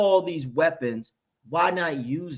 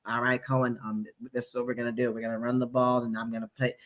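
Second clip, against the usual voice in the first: low, much softer timid coach voice; Bowles impression; 6 semitones lower, 7 dB quieter, faster than usual. Mocking Bowles briefing Cohen, low and meek. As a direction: murmur like a sheepish coordinator. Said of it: "I can see Bowles saying that shit".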